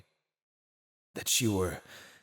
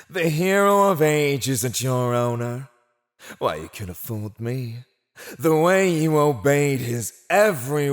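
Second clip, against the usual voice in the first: loud, theatrical scorn; mock the captive's devotion with swelling emphasis; scornful mockery; 4 semitones higher, 7 dB louder than usual. Villain mocks captive's devotion.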